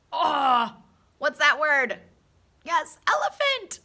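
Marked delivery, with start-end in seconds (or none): 2.6-3.8 high, bright reveal of elephant; delighted reveal; excited. Real, not acted. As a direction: announce it like a happy surprise.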